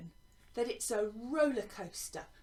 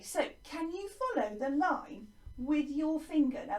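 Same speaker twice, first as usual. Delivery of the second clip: ordinary talk, nothing marked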